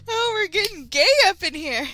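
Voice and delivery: high, loud gleeful remark; excited exclamation. Real, not acted. Reader reacting to the story, high and gleeful. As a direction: cackle it out to the viewers.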